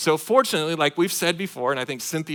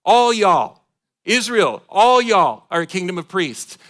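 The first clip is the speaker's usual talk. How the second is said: loud, higher booming declaration in God's voice; God proclaiming; 4 semitones higher, 9 dB louder, slower than usual. Acted. Voicing God to Israel, loud proclaiming declaration. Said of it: "God is speaking to the children of Israel".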